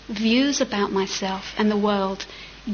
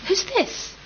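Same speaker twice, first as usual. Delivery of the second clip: pitched high with surprise; startled question; 10 semitones higher than usual. Pretend. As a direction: jump up in pitch, bewildered. Imagined yogi, puzzled.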